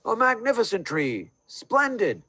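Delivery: high delighted exclaiming; excited cry. A narrator's reading. Mister Willoughby admiring his new tree, delighted high cry. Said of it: "he cried".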